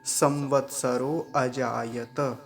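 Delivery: slow, each syllable separate; slow recitation. Shown as a demonstration. Teacher modelling the phrase slowly. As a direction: recite संवत्सरो अजायत slowly. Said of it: "ऐसा बोलेंगे".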